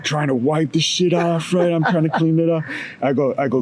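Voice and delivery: ordinary talk, nothing marked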